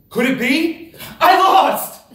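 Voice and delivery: stunned yell; shocked outcry. Real, not acted. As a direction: shout it before it sinks in. Expected win turns to loss.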